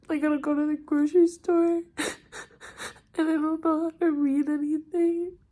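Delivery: overwhelmed and alone, weeping as you talk; crying. Real, not acted. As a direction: sob between short, halting phrases. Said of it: "enjoy seeing me cry".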